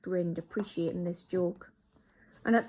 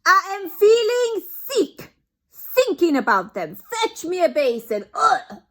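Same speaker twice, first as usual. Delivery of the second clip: much higher, loud, retching witch voice; sickened Grand High Witch; 11 semitones higher, 13 dB louder than usual. Acted. Grand High Witch calls for a basin, high loud retching. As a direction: act nauseous and demanding.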